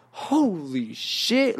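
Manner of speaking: slow-motion astonished exclamation; amazed. Retold or imitated. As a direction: stretch it out, blown away. Acting out the sudden rush.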